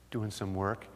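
normal talking voice

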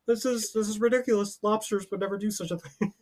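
quietly high impression of a pundit; Jordan Peterson. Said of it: "we go on Jordan Peterson's podcast".

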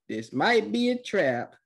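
high, slow cautioning voice; mock warning. Recapper voicing Sasuke and Sai's talk, hammed-up warning.

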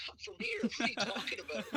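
high, incredulous pushback; indignant retort. Retold or imitated. To Scots asking where he's from, mock-indignant high retort. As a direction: scoff at the idea you're a foreigner.

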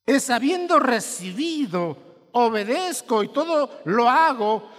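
slow, loud, high preacher's cry; impassioned sermon voice; full-force preaching. Real, not acted. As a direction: preach it out slowly with fervor.

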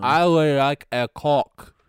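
mock Japanese accent, drawn out and loud. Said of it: "Also in a Japanese accent".

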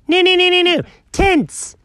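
high, silly mimicking babble; mocking imitation. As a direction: parrot it back in a high mocking voice. Mocks the psychiatrist's joke line.

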